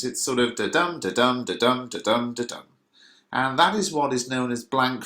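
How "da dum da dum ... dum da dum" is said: "da-dum" with a weak "da" and a strong "dum"; iambic sing-song beat. Shown as a demonstration. Teacher replaces words with sounds for students, rhythmic "da-dum" stressing each "dum". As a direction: bounce the "da-dum" evenly, hitting each "dum". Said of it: "replace the actual words with just the sounds".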